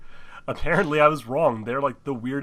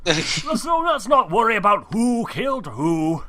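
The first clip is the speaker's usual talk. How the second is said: loud pompous voice; 8 semitones higher, 7 dB louder, slower than usual. Acted. Acted mayor line.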